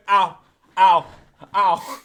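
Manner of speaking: loud, separated mock ow's; imitated pained yelps. Retelling a friend's beating, mock yelps of pain. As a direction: mimic a friend yelping under punches. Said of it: "he literally went".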